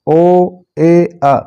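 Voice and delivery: ओ ए आ very slowly, one by one; sounds separated. Shown as a demonstration. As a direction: pause between ओ, ए and आ. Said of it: "तीन single sounds को एक sound".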